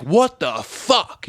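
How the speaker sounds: high, drawn-out "what the fuck"; re-enacted stunned exclamation; shocked disbelief. Acting his shocked reaction, retold.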